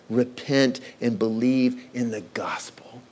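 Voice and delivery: hushed whisper of the words of Jesus. Acted. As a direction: breathe it out, no voice. Quoting Jesus at the climax.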